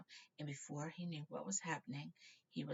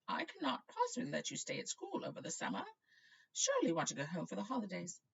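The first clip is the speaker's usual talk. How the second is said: put-on much higher frail old wizard; old headmaster; 5 semitones higher, 5 dB louder than usual. Dippet refusing Riddle gently, high and frail. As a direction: speak as a kindly old headmaster.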